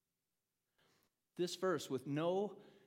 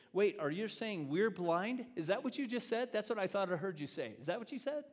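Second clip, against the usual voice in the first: high, louder disbelieving questions; incredulous Pharisees; 6 semitones higher, 4 dB louder than usual. Acted. Pharisees interrupting Jesus, high-pitched and incredulous. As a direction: demand he repeat himself, stunned.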